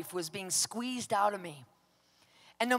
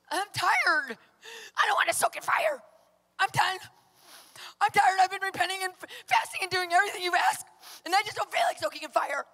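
high-pitched, mock-crying complaint; whiny protest; 9 semitones higher, 3 dB louder than usual. Telling God she is too tired to obey, whining. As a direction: whine it like a worn-out child refusing. Acted exhausted refusal to God.

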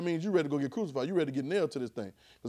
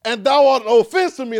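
loud stern scolding, voice raised high; booming rebuke; 7 semitones higher, 14 dB louder than usual. Acted. Preacher voicing Jesus rebuking Peter, booming. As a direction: thunder it at him like a rebuke.

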